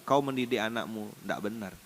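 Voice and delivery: ordinary talk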